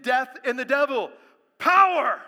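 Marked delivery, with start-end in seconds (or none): 1.6-2.2 loud, high shout of the word; full-force pulpit shout; preached cry. Real, not acted. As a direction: hit it like a thunderclap. Preacher's climactic one-word cry.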